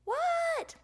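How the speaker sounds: high, loud shouted question; yelled reply. A narrator's reading. Froggy yells back to mom.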